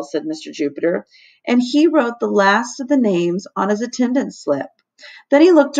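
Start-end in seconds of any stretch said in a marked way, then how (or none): none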